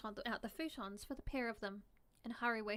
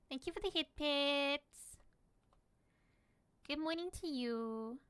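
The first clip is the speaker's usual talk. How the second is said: higher, slow cutesy thank-you; 4 semitones higher, slower than usual. Pretend. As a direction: coo your thanks like a pet. Thanking viewer in cute voice.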